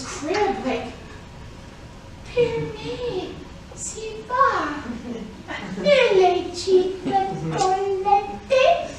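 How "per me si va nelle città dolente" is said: put-on very high, slow, sing-song baby talk; lullaby to a baby. Shown as a demonstration. Crooning Dante's lines to a baby in its crib. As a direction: coo it like a lullaby over a crib. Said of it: "say to, like, your little baby".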